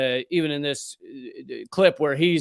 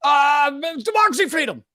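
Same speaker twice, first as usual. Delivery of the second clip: higher, louder mock stammered slogan; mocking politician; 10 semitones higher, 5 dB louder than usual. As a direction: parrot the empty slogans. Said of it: "And then he goes".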